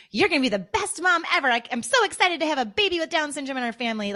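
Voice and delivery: high, fast, gushing mock cheer; mock toxic positivity. Mother mimicking relentlessly upbeat friends, gushing mock cheer. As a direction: overdo the excitement till it rings false. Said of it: "Like, the toxic positivity".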